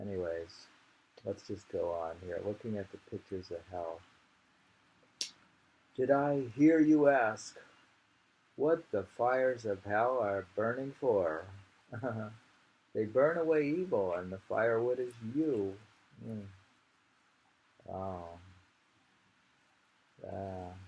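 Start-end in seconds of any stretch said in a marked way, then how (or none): 6.0-15.7 dramatic poem reading. Acted. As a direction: boom it out like a hellfire preacher. Reads a dramatic poem aloud.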